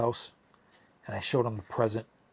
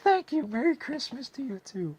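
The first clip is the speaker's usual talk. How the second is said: much higher, sing-song mom thanking; high mother voice. Retold or imitated. Imitating Peter's mom thanking him, high and sweet. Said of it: "She's like".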